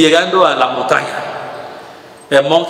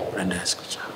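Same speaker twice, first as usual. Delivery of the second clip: breathy whispered monk; 9 semitones lower, 16 dB quieter, much faster than usual. Retold or imitated. Monk whispering to the frantic father. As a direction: whisper it so he can't hear. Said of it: "no escuchó".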